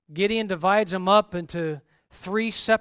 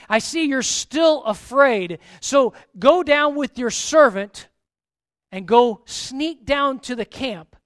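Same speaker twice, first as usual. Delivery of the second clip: loud, higher, driving sermon voice; full-force preaching; 4 semitones higher, 8 dB louder than usual. Acted. Preacher driving the Gideon story home, full force. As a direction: preach it hard to the congregation.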